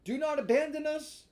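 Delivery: high, loud desperate plea; pleading fox voice. Acted. Fox begging Pinocchio, high loud desperate plea. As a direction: beg not to be left behind.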